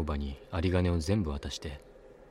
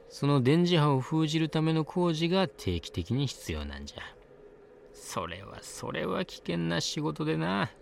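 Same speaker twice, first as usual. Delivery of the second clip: put-on high old woman voice; 7 semitones higher than usual. A narrator's reading. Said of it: "ワンオクターブ高い声で".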